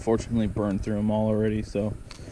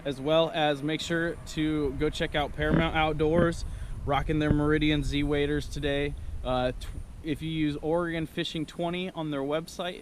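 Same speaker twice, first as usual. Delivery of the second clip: higher, upbeat ad read; sponsor outro; 6 semitones higher than usual. Acted. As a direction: wrap up the vlog cheerfully.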